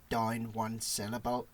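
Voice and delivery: pronounced Northern Irish vowel, "down" like "dine"; Northern Irish accent. Shown as a demonstration. Co-host demonstrates accent he likes, Northern Irish. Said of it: "I quite like the Northern Irish accent".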